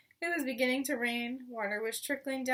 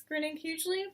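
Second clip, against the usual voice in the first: ordinary delivery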